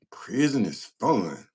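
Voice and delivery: "Prison is fun" drawn out slowly; slow, stretched "Prison is fun"; incredulous repeat. Retold or imitated. Repeats comment in disbelief, drawn out.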